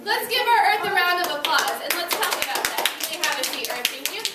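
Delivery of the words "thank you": normal talking voice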